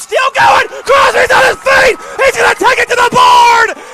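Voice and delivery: very high, very loud shouted play-by-play; screaming touchdown call. Real, not acted. Announcer calling the winning touchdown, full-throated shout. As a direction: scream the touchdown like the stadium just erupted.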